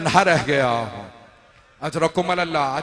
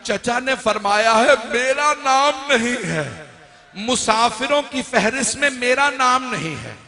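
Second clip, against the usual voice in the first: put-on a child complaining to her father; lightly voiced little girl; 5 semitones higher than usual. A narrator's reading. (ऊँची, धीमी, मासूम फ़रियाद)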